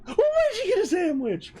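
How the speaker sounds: high, giddy, laughing squeal; gleeful high quote. Retold or imitated. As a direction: play the boyfriend's giddy delight. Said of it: "with eyes of glee".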